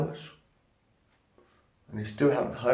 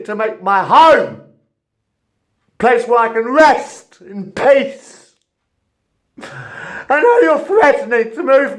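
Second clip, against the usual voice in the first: loud, much higher, slow raging yell; furious shout; 9 semitones higher, 12 dB louder, slower than usual. Real, not acted. Tenant raging at the housing company over his flat, furious shouting. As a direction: scream the grievance out at them. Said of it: "Sorry, people. Lost my temper".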